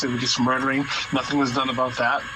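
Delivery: ordinary delivery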